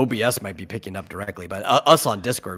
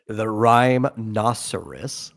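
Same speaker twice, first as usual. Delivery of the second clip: slow; much slower than usual. Acted. Playing with the made-up word.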